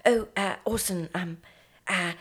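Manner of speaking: soft, halting little British director; stammering director. Retold or imitated. Director meekly asking Orson for another take, stammering. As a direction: timidly hem and haw through the request. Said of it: "stammering, like, weird little director guy".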